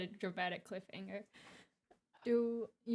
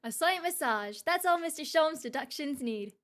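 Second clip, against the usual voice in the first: loud, high theatrical gentleman detective; British detective voice; 8 semitones higher, 6 dB louder than usual. Acted. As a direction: cheer on your partner grandly. Character encouraging partner.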